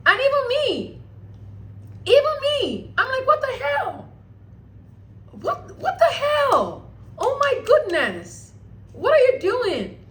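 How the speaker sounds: high-pitched, drawn-out outraged disbelief; squealing mock outrage at a stunning betrayal; shocked disbelief. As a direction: wail it in stunned protest, pitch way up. Retelling own shock at groom's refusal.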